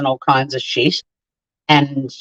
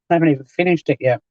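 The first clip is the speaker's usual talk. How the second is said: normal talking voice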